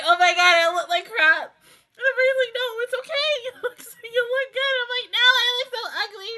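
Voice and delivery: high, squeaky tearful voice; self-mocking back-and-forth while still weeping; crying. Real, not acted. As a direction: whine through real tears, embarrassed by crying. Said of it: "And I hate crying".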